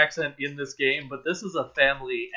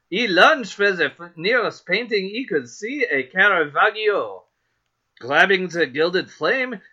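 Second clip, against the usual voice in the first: hammed-up French accent, higher and louder; 5 semitones higher, 5 dB louder than usual. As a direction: read it in a singsong, swooping French accent. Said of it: "I'm going to say French".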